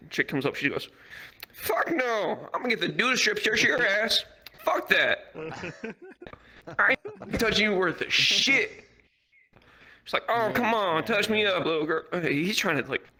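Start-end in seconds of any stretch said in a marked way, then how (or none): 1.5-8.8 high, emphatic sassy refusal; sassy lady. Retold or imitated. Officer refuses to strip search passenger, sassy. 10.3-12.0 high, wheedling mock coaxing; sweet talk. Crackhead tries to charm the officer, wheedling sweet talk. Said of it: "sweet talk this fucking shit".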